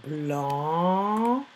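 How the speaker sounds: "Blanche" with a long rising blaaan, pause, che; stretched syllables. Shown as a demonstration. Teacher dictating slowly to pupils, "Blanche" in stretched syllables. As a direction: draw out both halves of "Blanche" separately. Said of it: "Première syllabe à quatre lettres".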